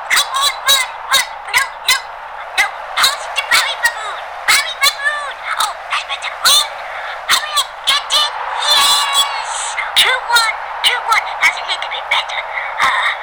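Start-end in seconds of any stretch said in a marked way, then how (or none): 0.1-11.3 excited football fan. Acted. [name] cheering the match.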